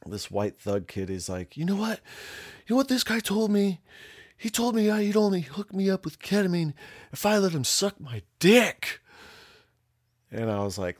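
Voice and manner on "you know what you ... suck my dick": very high, loud mock indignation; outraged complaint. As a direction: imitate an aggrieved kid, voice pitched way up. Aggrieved kid acted, protesting.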